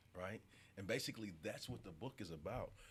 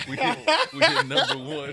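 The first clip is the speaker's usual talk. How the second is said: loud, laughing, high burst; excited shout; 21 dB louder than usual. Real, not acted. Bursts out after the whisper.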